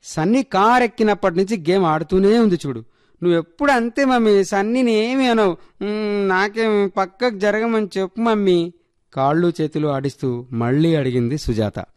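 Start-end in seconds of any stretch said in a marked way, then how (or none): 0.0-8.7 put-on high-pitched child grumbling to mummy; complaining little girl. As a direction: whine about your sister in a child's high voice. Voices complaining eight-year-old. (అమ్మకు ఫిర్యాదు చేసే చిన్నపిల్ల గొంతు)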